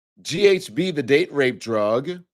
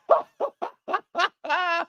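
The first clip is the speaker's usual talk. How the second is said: shrill burst of laughter, much higher; high shrieking laugh. Real, not acted. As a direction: squeal with laughter. Host cracking up at list.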